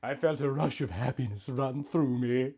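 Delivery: usual speaking voice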